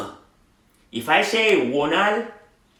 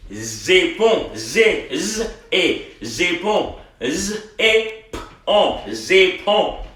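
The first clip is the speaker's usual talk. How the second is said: ordinary talk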